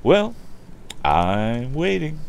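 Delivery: calm with a rising, stretched-out lilt; calm reply. Acted. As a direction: answer slowly, coolly goading. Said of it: "responded the other calmly".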